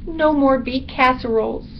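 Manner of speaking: high bat voice, mock mourning; woeful little bat; lamenting bat. A narrator's reading. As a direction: bemoan it up high. Bat laments lost meals.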